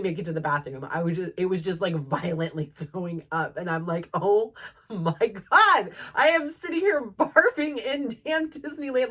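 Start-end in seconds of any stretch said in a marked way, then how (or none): 4.1-9.1 higher and louder, slow start, horrified disbelief; mock horrified. Retelling being sick in Disneyland, mock horrified. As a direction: let the shock rise as you picture it. Retold shock while sick.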